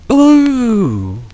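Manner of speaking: long high ghostly boo; spooky boo. Acted. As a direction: hoot it like a ghost. The mysterious sound.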